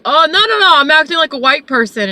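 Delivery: imitating a loud, high-pitched denial; emphatic protest. Passenger defending his act to the driver, emphatic mock protest.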